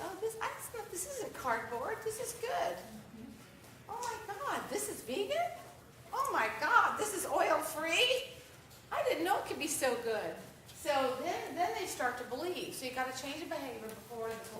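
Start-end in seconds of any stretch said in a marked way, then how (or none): 0.0-10.3 higher, gushing disbelief; Oh my God rising in delighted shock; amazed discovery. Retold or imitated. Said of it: "So after they say".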